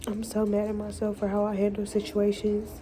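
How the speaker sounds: usual speaking voice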